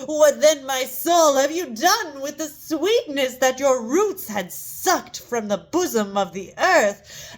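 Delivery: theatrical outcry, voice swelling high; anguished self-reproach. Acted. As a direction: cry out in bitter reproach to your own soul. Speaker laments and rebukes own soul.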